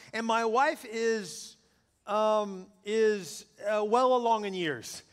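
slow, halting search for polite words; drawn-out pauses, choosing words with care; tactful hesitation. Acted. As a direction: stall carefully before a delicate phrase. Husband picking tactful words for wife.

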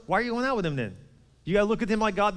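normal talking voice

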